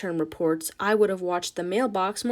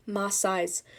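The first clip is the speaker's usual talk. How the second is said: ordinary talk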